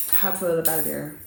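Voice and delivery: quiet echo. Real, not acted. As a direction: repeat it softly as an afterthought. Softer echo of the same words.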